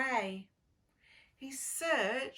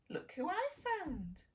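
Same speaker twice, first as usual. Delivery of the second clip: cheerful farmer; 7 semitones higher than usual. Acted. Farmer showing children duckling.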